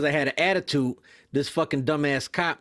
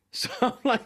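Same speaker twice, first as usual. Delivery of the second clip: ordinary delivery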